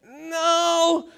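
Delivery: high, drawn-out no; stretched high-pitched comic no; mock denial. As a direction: imitate an awkward admission. Acted repeated sheepish denial.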